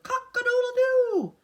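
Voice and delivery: high, loud held crow; hammed-up rooster call. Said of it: "who crowed".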